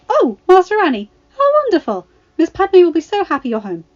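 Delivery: excited droid greeting. A narrator's reading. The droid greets Anakin on waking, high and delighted. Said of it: "Three P O greeted".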